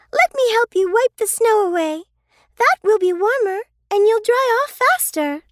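put-on high, quick helpful girl voice; eager little girl. Sister helping the snowy bear, high quick eager girl. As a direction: rush to help the bear.

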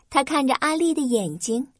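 plain reading voice

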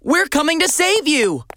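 calling out. Acted. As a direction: call out to someone hidden. Calling to the trapped tortoise.

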